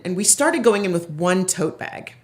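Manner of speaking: normal talking voice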